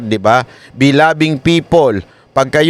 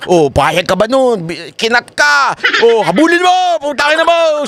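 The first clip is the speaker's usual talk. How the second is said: road rage shout; 11 semitones higher than usual. Retold or imitated. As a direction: goad then explode in rage. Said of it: "Ah, ganyan, di ba?". (galit na sigaw sa kalsada)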